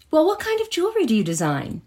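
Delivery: high, curious question; mock curious partygoer; curious woman.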